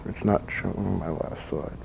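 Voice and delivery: very quiet, slow mumble to yourself; muttered aside. Real, not acted. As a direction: drop to a murmur, as if checking the slides. Aside about a slide not showing.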